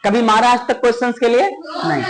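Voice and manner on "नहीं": ordinary delivery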